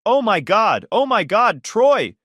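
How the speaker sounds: high, loud panicked shriek; horrified scream. Acted. Wife screams seeing husband's brother bleed, panicked. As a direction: scream in horror at the blood. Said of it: "Bridget screamed from the bedroom doorway".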